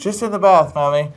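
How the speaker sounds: put-on high, loud English boy's reply with a laugh; flipped English accent. Son reassuring his mother on the phone, hammed-up English accent. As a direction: ham up an English child's call to mummy. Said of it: "it would flip like that".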